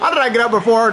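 laughing through the question; amused disbelieving laugh. Real, not acted. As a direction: giggle in disbelief as you ask. Laughs while repeating.

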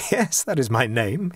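ordinary delivery, nothing marked

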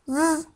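Z as a held buzz, "zzz"; letter sound demo. Shown as a demonstration. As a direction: sound out Z with no vowel. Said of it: "diye okunur". (Z'yi "zzz" diye uzat)

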